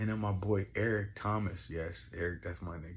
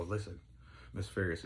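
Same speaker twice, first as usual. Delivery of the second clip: ordinary talk, nothing marked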